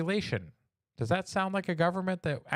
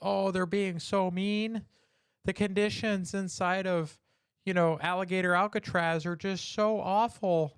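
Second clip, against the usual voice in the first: higher, slow mocking whine; mock whiny complainer; 5 semitones higher than usual.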